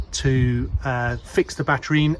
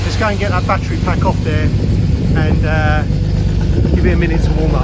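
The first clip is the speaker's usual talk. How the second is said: raised voice; 4 semitones higher, 4 dB louder than usual. Real, not acted. Talking over running engine.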